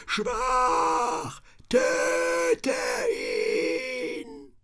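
shrill demonic shout. A narrator's reading. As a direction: scream it like a possessed thing. Demon voices taunt.

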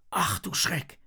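high-pitched gasped exclamation; Henry, startled. A narrator's reading. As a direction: blurt it out in alarm. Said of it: "stößt Henry da hervor". (erschrocken hervorgestoßen)

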